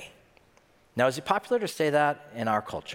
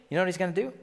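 usual speaking voice

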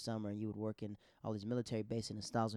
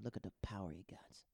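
soft unvoiced aside about dad's power; awed whisper; 3 semitones lower, 8 dB quieter than usual. Airman whispers to the son in awe, softer. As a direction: breathe it out, hushed and impressed.

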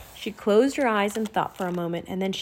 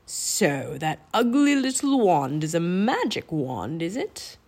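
slow, drawn-out sneer with swooping pitch; sneering villain king; 3 semitones lower, slower than usual. A narrator's reading. Raven King on hearing of the magic wand, slow sneer.

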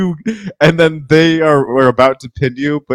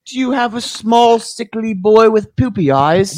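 snake oil salesman; 9 semitones higher than usual. Acted. Joins the mock sales pitch.

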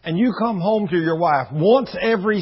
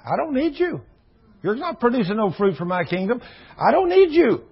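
stern God rejection; 7 semitones higher than usual. Retold or imitated. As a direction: cast him off firmly.

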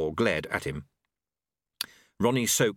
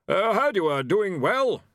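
high-pitched, awkward small talk; awkward hearty War; 9 semitones higher, 4 dB louder than usual. A narrator's reading. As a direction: make uneasy small talk in a raised voice. War's awkward greeting after coughing.

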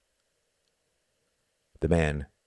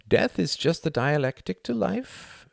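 murmuring; 13 semitones higher than usual. A narrator's reading. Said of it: "he imagined him murmuring".

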